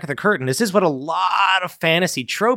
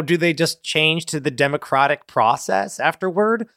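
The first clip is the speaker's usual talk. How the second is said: ordinary talk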